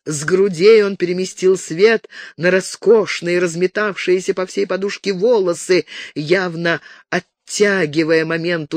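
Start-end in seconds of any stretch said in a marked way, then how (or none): none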